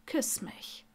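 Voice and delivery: very soft, breath-only order; hushed, teasing command; whispered demand. Acted. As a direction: whisper it as a private challenge. Intimate demand in acted scene.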